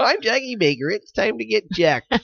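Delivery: squeaky imitation of the co-host; high mock intro voice. Mimics co-host's intro line.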